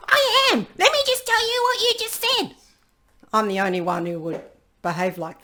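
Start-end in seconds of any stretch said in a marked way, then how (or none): 0.1-2.6 high, fast mocking protest; mock defensive reply. Listener mocked answering the accusation, high and fast. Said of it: "And you go".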